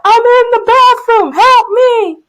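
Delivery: high, loud drawn-out holler; retold yell through wall. Teacher yelling through a brick wall, mock desperate shout. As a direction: shout it to people beyond a wall. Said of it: "I put my mouth to the wall".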